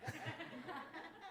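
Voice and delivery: a high giggling burst; real amused giggle; high laugh. Real, not acted. Laughing at her own joke.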